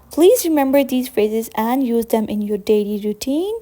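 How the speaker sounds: ordinary talk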